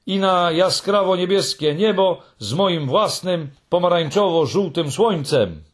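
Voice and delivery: high, insistent chanted recitation; Moomin's defiant verse, declaimed high; rebellious song. A narrator's reading. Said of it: "swoją pełną buntu piosenkę".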